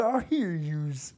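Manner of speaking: mock Southern accent. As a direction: tease her with a lazy Southern accent. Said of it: "Do I have a southern accent?".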